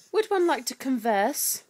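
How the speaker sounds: slow, loud, high put-on grandeur; mock-formal. Mum asking baby to converse, mock-formal voice. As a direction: address the baby with exaggerated formality.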